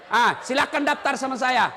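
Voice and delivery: ordinary talk, nothing marked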